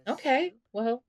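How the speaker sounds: put-on the girlfriend warming to the idea; voiced girlfriend. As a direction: girlfriend agreeing to him, high and pleased. Girlfriend voiced in Sims story.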